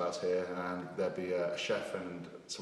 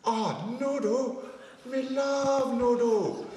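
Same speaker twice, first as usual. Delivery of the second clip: much higher slow mock Japanese accent; 4 dB louder, much slower than usual. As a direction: drawl it out in a put-on Japanese accent. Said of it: "And I'm like this".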